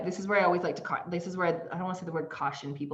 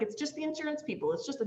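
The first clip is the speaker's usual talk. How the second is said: husband dismissing; 10 semitones higher, 4 dB quieter than usual. Retold or imitated.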